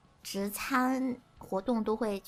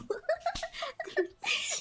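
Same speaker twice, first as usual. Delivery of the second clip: high rising giggle; 3 dB quieter than usual. Real, not acted. Laughs at interruption.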